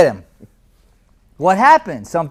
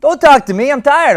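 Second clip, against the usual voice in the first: loud, high grumpy tired; big energy even when exhausted; tired but big; 11 semitones higher, 9 dB louder than usual. Shown as a demonstration. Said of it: "You're tired".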